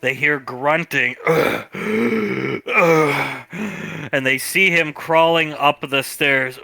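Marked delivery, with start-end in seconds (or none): 1.2-4.1 pained groan. Acted. Dying man on video groaning.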